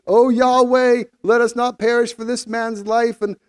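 high, pleading cry to God; calling out in prayer. A narrator's reading. Sailors call out to Yahweh for their lives, pleading prayer. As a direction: plead aloud for mercy. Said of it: "they called out to Yahweh".

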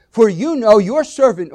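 "you" and "your" punched high and loud; stress on YOU and YOUR. Shown as a demonstration. Preacher reading David's words to God, "you" and "your" stressed. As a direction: hit "you" and "your" hard. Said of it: "put emphasis on certain words".

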